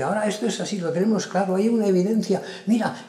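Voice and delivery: a hint of someone insisting they have it all figured out; confident claimant. Bat announcing there is clear evidence, lightly voiced confident claim.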